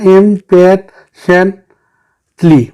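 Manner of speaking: impatiently very slowly in four beats; long pauses between the syllables of impatiently; split into syllables. Shown as a demonstration. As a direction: count out impatiently piece by piece. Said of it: "ये हमारे पास कितने हो गए".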